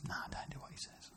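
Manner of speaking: quick whispered confession; mock whisper. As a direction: whisper it like a sheepish excuse. Mocking a disobedient believer.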